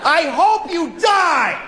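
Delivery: high, slow repeat of the yell; hammed-up angry shout, stretched; mock shout. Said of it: "I said that to a person".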